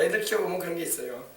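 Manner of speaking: usual speaking voice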